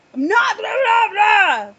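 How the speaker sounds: loud, high half-asleep yell; loud, high mock outburst from a sleeper; retold yelling. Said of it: "yelled at him".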